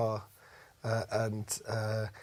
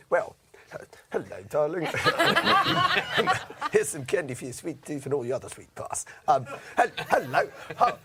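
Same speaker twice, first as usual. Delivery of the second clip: a hammed-up suave Cary Grant voice, higher and laughing; cheesy Cary Grant seducing an audition panel; 5 semitones higher, 3 dB louder than usual. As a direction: play the smooth charmer, laughing through it. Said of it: "passable Cary Grant impression".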